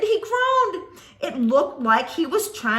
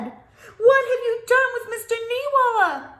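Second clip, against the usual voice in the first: frightened question; 8 semitones higher, 5 dB louder than usual. Acted. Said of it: "Yes, I said".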